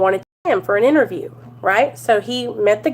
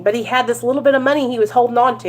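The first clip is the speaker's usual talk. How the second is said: normal talking voice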